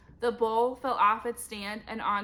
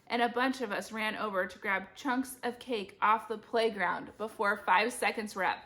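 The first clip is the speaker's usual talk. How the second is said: ordinary delivery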